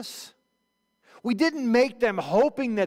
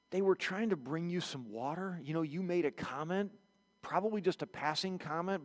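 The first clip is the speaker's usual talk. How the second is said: hesitant bad news; 3 semitones lower, 7 dB quieter than usual.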